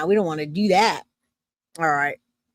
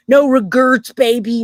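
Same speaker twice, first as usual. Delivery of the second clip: loud boast; 3 semitones higher, 8 dB louder than usual. Real, not acted. Proud tattoo boast.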